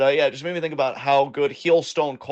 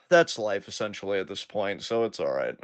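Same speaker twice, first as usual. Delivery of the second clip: resigned; 7 semitones lower, 7 dB quieter than usual. Real, not acted. Accepting a disappointing match finish.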